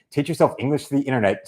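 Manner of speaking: ordinary talk, nothing marked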